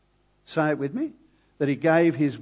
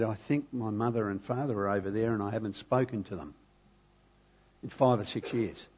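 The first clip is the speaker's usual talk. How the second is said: soft, low quoted voice; subdued retold confession; 4 semitones lower, 6 dB quieter than usual. Preacher retelling an estranged daughter's admission, subdued. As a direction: gently voice a hesitant confession, understated.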